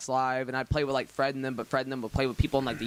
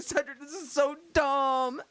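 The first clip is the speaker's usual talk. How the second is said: mock crying; 12 semitones higher, slower than usual. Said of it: "she starts crying as we're driving".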